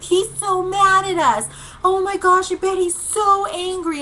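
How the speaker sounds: high, excited mock panic; alarmed teen passenger. Sister-in-law in the back seat about the van driver, mock alarm. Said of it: "the sister-in-law, she's like".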